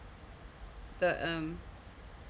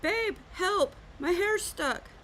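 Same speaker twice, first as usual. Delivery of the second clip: panicked call for help; 12 semitones higher, slower than usual. Retold or imitated. Wife calls husband for help, high panicked plea. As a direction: squeal it out like your hair is caught. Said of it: "I will say".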